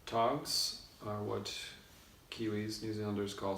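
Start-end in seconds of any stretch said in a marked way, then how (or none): none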